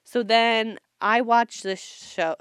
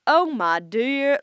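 slow, higher, genteel Southern "Oh, my dear"; Southern accent; 5 semitones higher, 5 dB louder, much slower than usual. Retold or imitated. Imitating a Mississippi boy's formal Southern accent. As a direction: drawl it like a formal Southern gentleman. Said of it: "it's almost formal".

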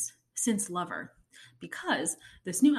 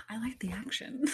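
whisper; 3 semitones higher, 9 dB quieter than usual. Real, not acted. Wistful confession.

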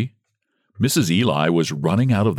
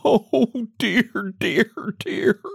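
hysterical laugh; 13 semitones higher, much slower than usual. Acted.

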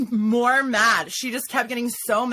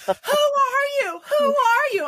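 imitated screaming; 3 dB louder than usual. Storyteller imitating the woman who followed her inside, mock screaming. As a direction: mimic a screeching demand, pitched way up. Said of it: "screaming at the top of her lungs".

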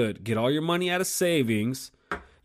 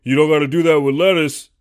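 louder, put-on mocking voice of a vegan; 8 dB louder than usual. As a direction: mimic a smug vegan to ridicule him. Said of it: "in your mind, you say".

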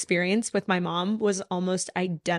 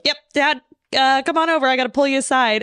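calling out; 6 semitones higher, 5 dB louder than usual. Retold or imitated. Calling dad over.

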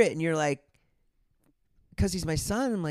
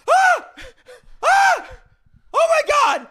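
much higher, loud screams; excited screaming; 16 dB louder, slower than usual. Retold or imitated. Father reenacting his scream at the DM. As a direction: shriek with joy. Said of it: "I went".

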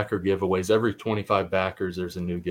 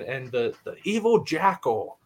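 put-on high, bright old-time announcer call; 1940s radio announcer; 5 semitones higher than usual. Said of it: "a nineteen forties radio announcer".

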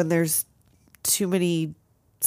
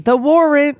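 dramatic loud; 5 semitones higher, 6 dB louder than usual. Pretend. Loud mock-dramatic line.

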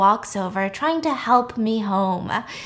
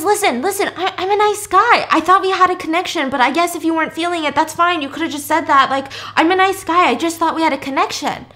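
defensive nice guy; 6 semitones higher, faster than usual. Retold or imitated. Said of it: "And so he's freaking out".

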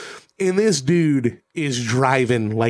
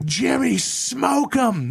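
retold egging on; 9 semitones higher, much slower than usual. Drunk passenger eggs on the cab driver, mock excited. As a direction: urge the driver on, pitched right up.

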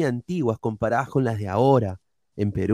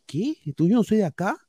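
acted-out incredulous question, pitched high; mock indignation, voice jumping up; indignant retort; 8 semitones higher than usual. Man retelling his reply in Lima to being called an outsider, indignant.

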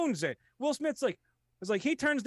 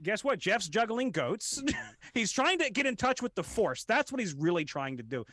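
ordinary talk

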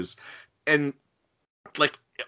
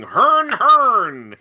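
loud, high mock-American honk with overdone R's; bad American accent; 10 semitones higher, 9 dB louder than usual. Mimicking Goon Show players doing Americans, hammed-up American accent. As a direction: hit the R's absurdly hard in fake American. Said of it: "They would really hyper enunciate the R's".

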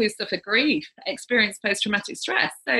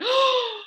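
high sharp intake of breath; sharp gasp. Retold or imitated. Medium gasps at the spirit's message, high and sharp. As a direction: gasp in shock at the revelation. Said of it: "and I went".

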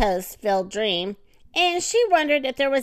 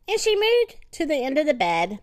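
ordinary delivery, nothing marked

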